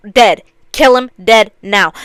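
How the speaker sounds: loud, punched-out verdict; emphatic loathing. Real, not acted. Fan condemning a disliked character, loud emphatic loathing. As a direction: hammer each word out hard.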